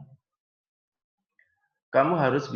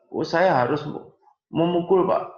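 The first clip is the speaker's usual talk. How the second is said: student's voice, a bit higher and louder, wanting to hit; mock agitated student; 8 semitones higher, 6 dB louder than usual. Nursing teacher acting a hot-headed student asking to hit, higher and louder. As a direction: play the worked-up student pleading with the teacher.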